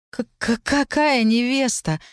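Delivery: high, stuttering disbelief; slow, high, flabbergasted query; stunned stammer. A narrator's reading. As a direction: stumble on the first sound, then stretch the question. Stunned heroine stammers at the news.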